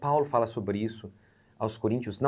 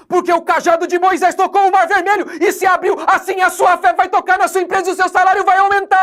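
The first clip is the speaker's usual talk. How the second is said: much higher, louder hammed-up preacher shout; over-the-top impostada preacher voice, high and loud; mocking Pentecostal preacher; 13 dB louder than usual. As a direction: parody a showman pastor whipping up the crowd. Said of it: "as profetadas".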